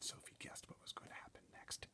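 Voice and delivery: ordinary delivery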